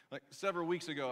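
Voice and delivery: usual speaking voice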